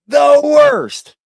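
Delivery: very high, loud squeal; high-pitched exasperated cry; the worst. Real, not acted. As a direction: shriek out how bad it was. Mocking the troll.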